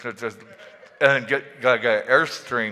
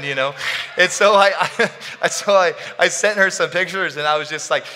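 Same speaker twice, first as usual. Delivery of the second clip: usual speaking voice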